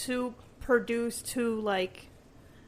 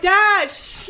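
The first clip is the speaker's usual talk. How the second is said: mock hushing; 8 semitones higher, 14 dB louder than usual. Imitating her hushing her dad.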